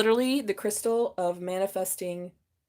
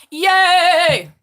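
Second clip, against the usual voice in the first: high, loud yay; a real burst of delight; excited cheer; 8 semitones higher, 12 dB louder than usual. Real, not acted. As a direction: cheer it out, thrilled. Celebrating love for Cancer.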